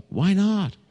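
lightly voiced little girl asking why; child's question. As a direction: pitch it up like a small child asking.

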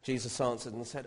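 ordinary talk, nothing marked

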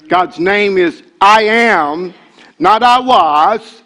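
preacher catching fire; rising preaching fervor. Real, not acted. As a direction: build the fire into the words. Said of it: "calm down, calm down".

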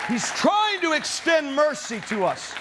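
rally the congregation to fight; thundering sermon build to a shouted peak; fiery preaching. Acted. Preaching at full force.

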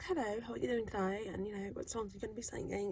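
soft British accent, imitated. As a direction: put on a quiet, polite British accent. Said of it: "one of them has a British accent".

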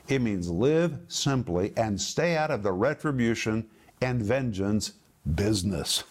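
ordinary talk, nothing marked

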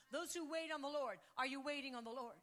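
normal talking voice

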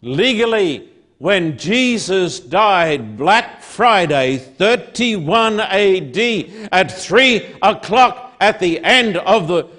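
slow, loud, weighty pulpit oratory; emphatic, drawn-out sermon proclamation; full-force preaching. Real, not acted. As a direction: drive each phrase home to the congregation.

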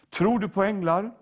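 ordinary talk, nothing marked

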